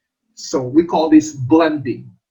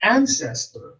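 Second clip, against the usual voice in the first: "Ancestor" with AN pitched higher; first-syllable stress; 4 semitones higher, 3 dB quieter than usual. Shown as a demonstration. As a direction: say AN-ces-tor for "Ancestor", falling after AN. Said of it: "the first syllable is accented".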